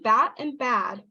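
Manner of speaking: BAT short, BAD with a long vowel; vowel length contrast. Shown as a demonstration. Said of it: "bad is pronounced longer".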